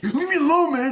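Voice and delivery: very high, loud squealed protest; mock frantic yell. Corey fighting off the bullies, mock frantic yell. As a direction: yell it in a hammed-up panic.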